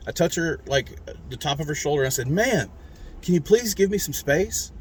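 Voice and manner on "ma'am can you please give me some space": polite raised ask, smiling; kind but firm. Retold or imitated. To the woman crowding him, kind but firm. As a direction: stay friendly despite the irritation. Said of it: "aggravated, but I'm trying to be kind".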